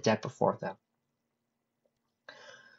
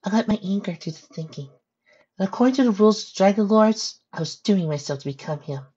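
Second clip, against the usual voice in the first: lightly voiced pony, higher pitch; a hint of a higher character voice; 8 semitones higher than usual. Pony confessing to her friend, lightly voiced higher.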